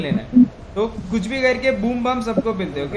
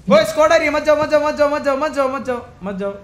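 high, rapid-fire warning, easing off; urgent warning; 4 semitones higher, faster than usual. Real, not acted. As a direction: warn the squad off in a hurry. Warns squad of approaching enemies. (घबराई, ऊँची चेतावनी)

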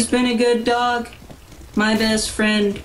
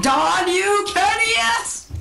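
high, slow villain cry; supervillain voice; 11 semitones higher, much slower than usual. Pretend. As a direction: gloat like a cackling supervillain. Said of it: "I sound like some supervillain".

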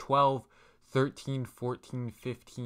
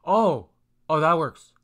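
excited; 4 semitones higher, 9 dB louder than usual. Real, not acted. Password finally accepted.

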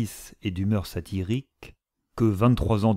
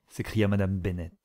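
usual speaking voice

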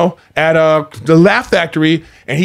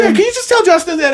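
imitating a fan girl; 10 semitones higher than usual.

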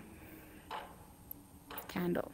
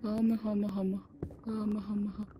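scared whimper; 4 semitones lower, 6 dB quieter, faster than usual. Pretend. Scared, calling mother softly.

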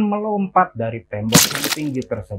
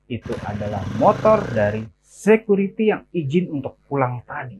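ordinary delivery, nothing marked

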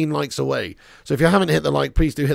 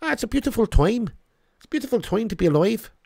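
high-pitched put-on Irish lilt; Irish accent; 6 semitones higher than usual. Streamer showing chat that Irish is better, hammed-up Irish accent. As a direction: go up high into a playful Irish brogue. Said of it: "Irish is better".

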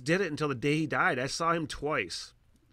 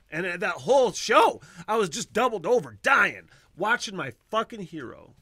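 higher, louder excited recounting; 7 semitones higher, 9 dB louder than usual. Real, not acted. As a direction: gush about the show, almost laughing. Recalls laughing hard at show.

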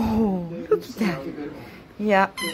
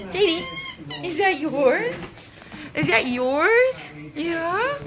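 cooing at the dog; 6 semitones higher than usual. Real, not acted. Owner coos at her dog.

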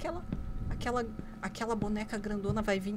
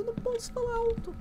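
strained high squeal climbing at the end; fretting, voice rising much higher; can't speak loud; 13 semitones higher, 3 dB louder than usual. Real, not acted. Player reminding herself she can't talk loud, strained squeal.

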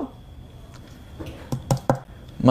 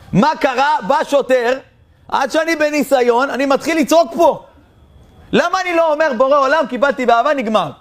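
much higher, worked-up self-reproach; self-scolding outburst; 10 semitones higher than usual. Retold or imitated. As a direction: berate yourself in a high excited voice. Driver scolds himself in memory. (נהג נוזף בעצמו, בקול גבוה ונרגש)

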